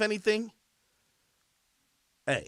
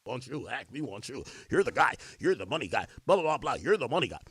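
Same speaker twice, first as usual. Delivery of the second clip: mock caller voice; 6 semitones lower, 7 dB quieter than usual. Mocking callers wanting him.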